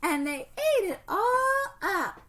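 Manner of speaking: high, slow whimpering cub voice; crying baby bear. Acted. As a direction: sob it out like a little cub. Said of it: "sputtered Baby Bear".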